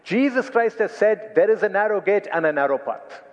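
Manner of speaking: ordinary talk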